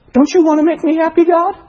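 put-on high, quick childish wheedling; spoiled child. Believer demands God make them happy, spoiled-child wheedling. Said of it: "A spoiled child".

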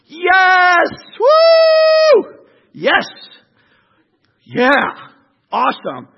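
loud, high whooping celebration; cheering crowd. Acted. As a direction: cheer like a newly freed people. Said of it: "I just see a people like".

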